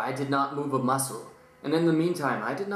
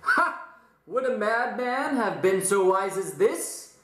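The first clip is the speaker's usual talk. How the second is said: mad narrator; 5 semitones higher, slower than usual. Acted. Dramatic reading of madman narrator.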